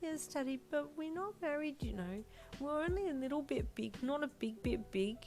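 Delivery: put-on high little-girl voice; earnest, high childish protest; mild indignation. A narrator's reading. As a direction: explain indignantly as a small child. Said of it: "she said with indignation".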